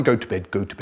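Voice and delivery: low, soft monotone chant; droning nagging brother. Retold or imitated. Older brother endlessly ordering sibling to bed, low nagging drone. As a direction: drone it like a stuck record.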